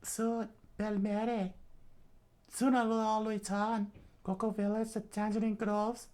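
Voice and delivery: put-on high-pitched girl's inner monologue; high voice musing to herself; Nami thinking to herself. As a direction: think aloud in a high, unhurried voice. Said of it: "she thought to herself".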